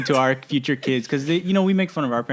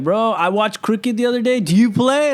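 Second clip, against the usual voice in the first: higher-pitched, overeager bro question; raised, chummy mock enthusiasm; imitated eager stranger; 8 semitones higher, 4 dB louder than usual. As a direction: mimic someone keen to bond over a sport.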